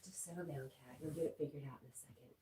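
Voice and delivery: very soft mutter to oneself; quiet self-soothing. Real, not acted. Streamer calming herself over the tripod, hushed self-soothing. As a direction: talk yourself down under your breath.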